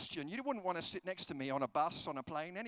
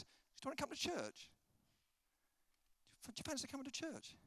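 high, quick, softened question; light, discreet asking; soft invitation; 7 semitones higher, 8 dB quieter, faster than usual. Shown as a demonstration. Preacher modelling inviting a friend to church, soft invitation.

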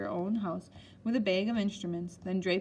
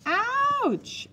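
very high, loud, long cry of pain; screaming; 5 dB louder than usual. A narrator's reading. Boy fakes pain when the doctor prods, screaming. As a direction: scream it out, stretched and piercing. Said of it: "I screamed when he touched the vital spot".

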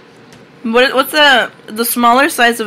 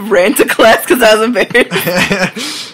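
ordinary talk

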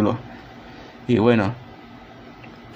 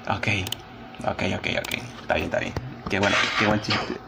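muttered sarcasm; 3 semitones lower, 7 dB quieter than usual. Pretend. Sarcastic reaction to bad joke.

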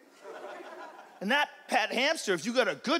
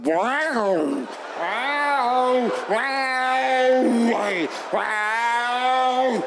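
long, loud demonic cat yowl; drawn-out cat wailing, swelling louder; exorcist cat yowls; 2 semitones higher, 4 dB louder, much slower than usual. Acted. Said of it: "Cats, they would make exorcist noises".

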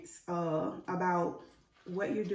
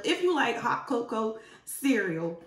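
ordinary talk, nothing marked

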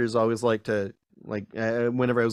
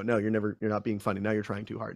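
lower, softer put-down; mock dismissal; 4 semitones lower, 6 dB quieter, faster than usual. Others telling him he is not funny, mock dismissal. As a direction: drop the voice and dismiss it.

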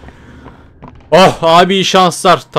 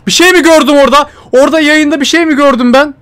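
higher and louder, breathless disbelief; shaken, scared; 10 semitones higher, 6 dB louder than usual. Real, not acted. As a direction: ask in a panic what you saw. (korkmuş, yüksek sesle telaşlı soru)

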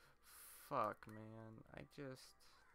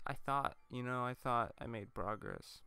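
normal talking voice

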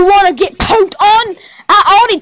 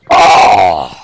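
hoarse, harsh play scream; agonized groan. Pretend. Toy victim being tormented, hoarse agonized cry. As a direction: let out a rasping mock-agony cry.

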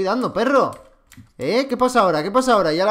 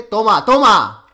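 triumphant shout; 2 semitones higher, 8 dB louder, much slower than usual. Real, not acted. Shooting an enemy.

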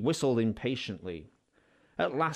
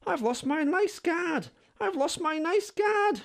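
much higher mock wail, no real sobs; Clarabel sobbing; 3 dB louder than usual. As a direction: wail it like a storybook carriage. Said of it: "she sobbed".

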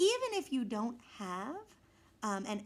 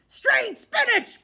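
much higher, louder and slow on Strange spinach; shocked pirate shout; 12 semitones higher, 12 dB louder, much slower than usual. A narrator's reading. Pirate repeating the boy's words in outrage. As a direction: bellow Strange spinach in disbelief. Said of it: "Braidbeard said".

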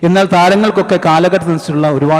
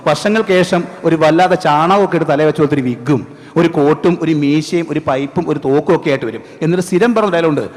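normal talking voice

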